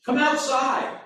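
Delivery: "Come outside." sharp and high, exclaimed; the pastor exclaims the literal Greek command; exclamation. Shown as a demonstration. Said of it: "It's an interjection, an exclamation".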